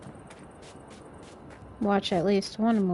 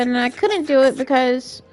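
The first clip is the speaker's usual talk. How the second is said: real raised, overloud voice; yelling; 4 dB louder than usual. Real, not acted. Streamer explaining why it was left undone, yelling. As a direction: get needlessly loud while explaining. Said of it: "I don't know why I'm yelling".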